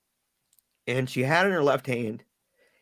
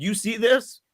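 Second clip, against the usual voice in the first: higher, pressing demand; angry demand; 8 semitones higher than usual. Retold or imitated. Dad confronting daughter over her old letter, angry. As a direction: jab the question at her.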